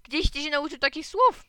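high, loud, indignant demand; outraged mother questioning her son; shouted question. A narrator's reading. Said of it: "krzyknęła mama". (oburzone, podniesione pytanie)

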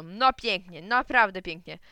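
fuming father pretending approval; sarcastic. A narrator's reading. (z oburzeniem i ironią)

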